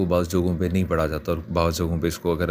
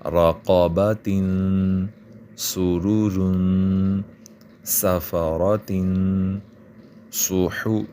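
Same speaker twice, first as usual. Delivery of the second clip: each word drawn out very slowly; very slow word reading; much slower than usual. Shown as a demonstration. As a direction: stretch every syllable for learners. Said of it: "میں ان کو پڑھتا جاتا ہوں". (ہر لفظ بہت آہستہ کھینچ کر)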